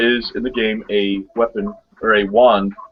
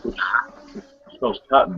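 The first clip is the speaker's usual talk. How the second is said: slow drawl; 3 semitones lower, 4 dB quieter, much slower than usual. Real, not acted. Joking about spelling KOTN.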